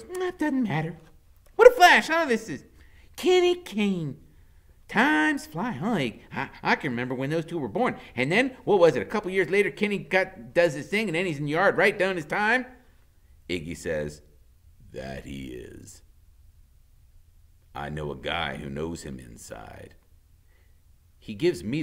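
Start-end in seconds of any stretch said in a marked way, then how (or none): 0.0-12.7 high, loud chatter; high giddy woman. A narrator's reading. Loretta guessing to Iggy, high and loud. 14.9-19.9 low menacing. A narrator's reading. Iggy low reply.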